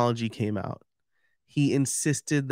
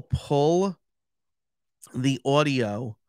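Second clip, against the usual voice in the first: "the audio" with a New York "aw" vowel in audio; New York accent; much slower than usual. Real, not acted. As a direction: say "the audio" like a New Yorker, ɔdioʊ. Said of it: "my New York accent, accent just came out".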